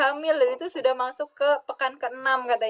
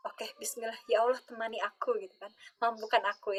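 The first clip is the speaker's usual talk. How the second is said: quiet prayer; 8 dB quieter than usual. Retold or imitated. Retold quiet prayer to Allah.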